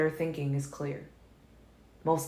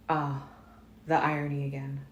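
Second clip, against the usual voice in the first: ordinary delivery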